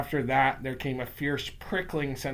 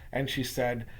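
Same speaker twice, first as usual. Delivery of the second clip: usual speaking voice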